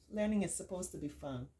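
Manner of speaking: usual speaking voice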